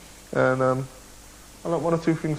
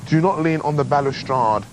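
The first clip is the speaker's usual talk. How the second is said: slow, loud and emphatic; deliberate, louder announcement; mock-official sign reading; 5 dB louder than usual. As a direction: read it out like a solemn warning. Reads out the famous sign.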